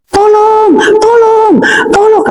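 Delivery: high, drawn-out call for help; retold cry for help. Woman fleeing to neighbours in the complex, acted high cry for help. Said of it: "saya teriak-teriak".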